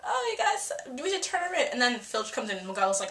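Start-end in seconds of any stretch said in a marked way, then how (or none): 0.0-1.7 high-pitched, whiny-style mimicry of a student; mock tattling student. Mimics students turning a classmate in.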